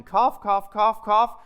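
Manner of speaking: hammed-up coughing; mock coughing. Preacher miming his coughing fit for the congregation.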